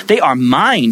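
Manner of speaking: usual speaking voice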